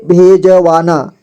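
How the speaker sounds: भेजवाना slowly split, with ज given a vowel; syllable by syllable. Shown as a demonstration. Teacher breaking down भेजवाना for learners, slowly syllable by syllable. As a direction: sound out भेजवाना slowly, piece by piece. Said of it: "जोड़ जोड़ करके".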